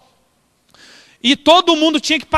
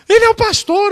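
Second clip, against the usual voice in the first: put-on tearful old lady; 9 semitones higher than usual. Crying woman defends the pastor.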